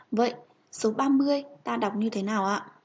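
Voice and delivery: ordinary delivery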